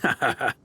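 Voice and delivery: light teasing chuckle; chuckle, owning up to the tease. Acted. Admits to pulling a leg.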